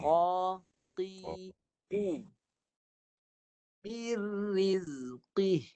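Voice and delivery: Qatiqu slow and heavy, qaf from the tongue root; thick qaf. Shown as a demonstration. Teacher shows correct thick qaf.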